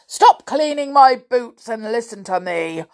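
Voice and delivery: loud, commanding voice; bossy storybook giant; giant's order. A narrator's reading. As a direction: give it as a forceful command. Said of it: "ordered the giant".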